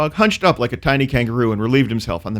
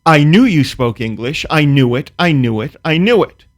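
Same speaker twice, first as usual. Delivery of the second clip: louder, crowing I knew it; triumphant shout; 4 semitones higher, 6 dB louder than usual. Retold or imitated. Neighbor gloats at the narrator, triumphant and loud. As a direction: gloat like you finally caught him. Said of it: "shouted triumphantly".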